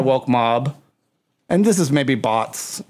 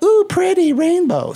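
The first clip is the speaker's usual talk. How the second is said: put-on high, loud kid-like awe; childlike wonder; 4 dB louder than usual. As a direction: gush like a little kid. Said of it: "we're like".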